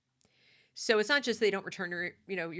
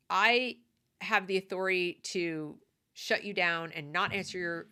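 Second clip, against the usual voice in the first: usual speaking voice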